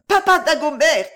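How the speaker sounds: loud, high-pitched call; crying out. A narrator's reading. Girl spots the guard on the bank, crying out. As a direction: shout to him for rescue. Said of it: "cria Claude".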